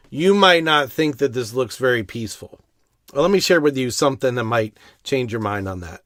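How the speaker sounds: usual speaking voice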